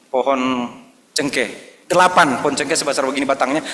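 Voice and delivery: ordinary talk, nothing marked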